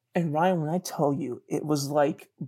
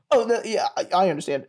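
higher-pitched stammering, settling down; flustered retreat; 4 semitones higher than usual. Retold or imitated. Rejected guy bowing out to the girl, flustered. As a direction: backpedal with an embarrassed half-laugh. Backs off after her refusal.

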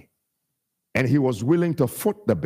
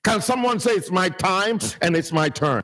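sung, chanted preaching cadence over music; full-force preaching; 7 semitones higher than usual. Acted. Pastor preaching to the congregation, chanting in a rising sung cadence. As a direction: whoop it up like a gospel preacher building to a climax.

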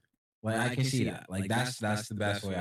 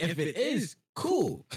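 squeaky, much higher "cool"; high sarcastic voice; 10 semitones higher, slower than usual. Retold or imitated. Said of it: "And I'm like".

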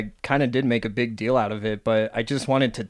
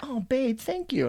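high mock sweet girlfriend; 13 semitones higher, 6 dB quieter than usual. Ex's reply imitated.

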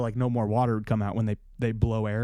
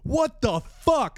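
much higher, slow disbelieving cry; stunned outrage; mock-shocked yelp; 12 semitones higher, much slower than usual. Reacting to flood.

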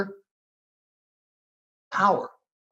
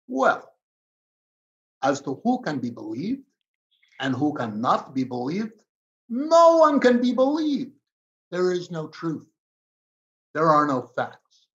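Putin's words in a hammed-up Russian accent. Host mimics Putin's denial of any truth in a Russian accent. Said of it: "I'll do it in a Russian accent".

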